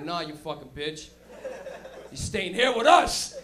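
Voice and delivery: laughing, slow and loud at the end; slow laughing insistence, rising to a shout; laughing friend. Retold or imitated. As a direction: friend laughing as he keeps a tripping buddy there. Friend holding tripping buddy.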